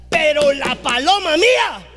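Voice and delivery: ordinary talk, nothing marked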